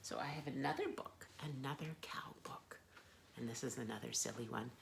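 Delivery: soft aside whisper; real whisper. Real, not acted.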